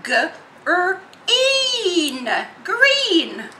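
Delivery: "g, r, een" rising to a high "green"; children's sounding out. Retold or imitated. Children answering teacher with green. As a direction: build up to the blended word. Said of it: "So they go".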